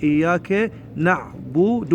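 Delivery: slow إياك نعبد as "na'-bu-du"; careful model, each syllable of نعبد drawn out; two dammas. Shown as a demonstration. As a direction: round both vowels of نعبد to "u". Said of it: "ضمتين".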